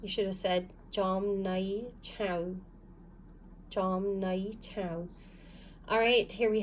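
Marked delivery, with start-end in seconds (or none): 0.9-2.5 Jom Nai Chow, slow and spelled out; slow model reading. Shown as a demonstration. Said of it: "So this is read". 3.7-5.1 Jom Nai Chow, slow, syllable by syllable; slow model reading. Shown as a demonstration. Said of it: "So this is read".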